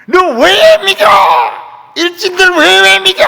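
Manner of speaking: high, full-voice angry yell; demonstrating how to holler at tormentors; venting scream. Shown as a demonstration. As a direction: let it rip at the top of your voice. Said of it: "그런 소리 지르려면".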